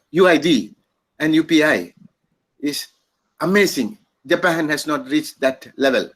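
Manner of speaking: lightly imitated Japanese accent, halting phrases. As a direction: quote the Japanese official with a hint of his accent. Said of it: "has that Japanese accent".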